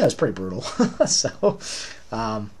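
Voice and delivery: ordinary talk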